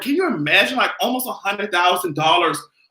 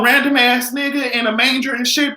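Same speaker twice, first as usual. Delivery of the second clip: ordinary talk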